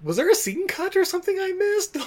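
confused viewer squeaking a question; baffled surprise. Retold or imitated. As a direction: voice jumps way up, puzzled. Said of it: "and I was like".

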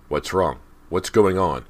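loud, urgent call. A narrator's reading. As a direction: call out in alarm to the household. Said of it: "Mister Fraser called".

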